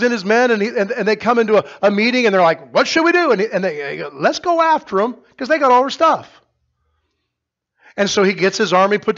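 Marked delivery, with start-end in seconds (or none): 2.7-3.3 high and loud worried asking; anxious loud question. Acted. Officers asking in meeting. 4.1-6.4 high, loud call to chase; rally the troops; eager rallying. Acted. Officers urging pursuit.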